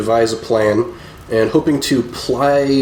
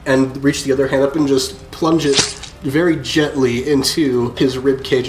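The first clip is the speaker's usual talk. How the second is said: normal talking voice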